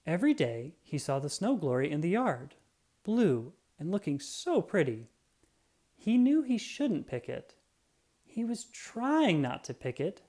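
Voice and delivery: usual speaking voice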